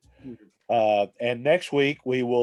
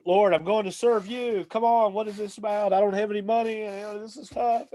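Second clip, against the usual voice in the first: higher-pitched, pleading whine; whine praying; 8 semitones higher than usual. Retold or imitated. Stranded man praying over his broken-down car, whining. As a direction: complain to God in a high whine. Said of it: "you ever whine pray".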